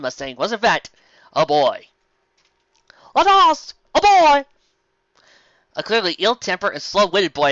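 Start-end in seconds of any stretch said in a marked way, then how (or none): 3.1-4.5 high, loud shocked cry; much higher, louder mock shock. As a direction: gasp it out in mock horror.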